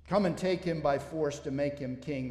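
ordinary talk

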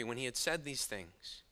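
ordinary delivery

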